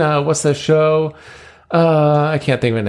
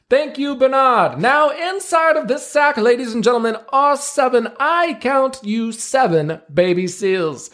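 higher pitched ringmaster patter, slow and grand; showman ringmaster; 12 semitones higher, slower than usual. Acted. Ringmaster presenting seals to the crowd, grand showman voice. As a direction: play the circus ringmaster working the crowd.

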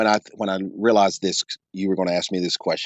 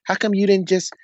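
shutting her down; 10 semitones higher, faster than usual. Retold or imitated. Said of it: "I just shut her down".

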